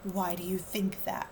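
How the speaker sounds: ordinary delivery, nothing marked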